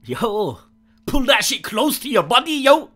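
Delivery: loud, high, excited yelling; hyped shouting. Real, not acted. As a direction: hype it up loudly. Hyped instruction during gameplay.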